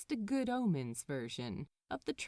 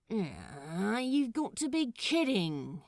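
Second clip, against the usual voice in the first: louder, exasperated grumble; voiced model rolling his eyes at the situation; grumbling Crowley; 3 semitones higher, 5 dB louder, slower than usual. Anthony, stuck with a stranger, grumbles in exasperation. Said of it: "Anthony grumbled".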